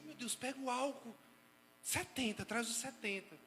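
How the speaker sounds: a hint of a panicked new mother; mock frantic mother. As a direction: play an anxious first-time mom panicking. Frantic mother over first child.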